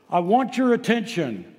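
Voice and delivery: high-pitched emphatic demand; exclaiming. Acted. Peter calling the elders to listen, exclaimed. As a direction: demand their attention. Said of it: "just comes right out and just exclaims".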